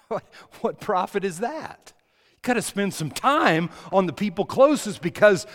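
ordinary talk

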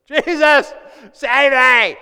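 high, loud cry for rescue; desperate cry. Acted. Preacher acting sinking Peter, crying loudly for help. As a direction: cry out to be saved. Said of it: "He starts singing".

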